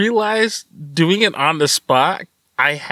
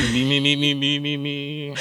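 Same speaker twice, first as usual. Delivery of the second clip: vocal warm-up; 4 semitones lower, 5 dB quieter than usual. Shown as a demonstration. Host warming up voice before retrying the spooky intro. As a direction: run the me-me-me warm-up. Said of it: "clears throat".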